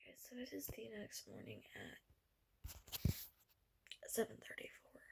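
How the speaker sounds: hushed late-night whisper in bed. Real, not acted. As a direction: whisper so nobody wakes.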